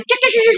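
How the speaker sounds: high squeaky chicken cries, imitated; dying rooster squawk. Pastor imitating the man's rooster squawk while being prayed for. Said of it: "persis kayak ayam jago dipotong".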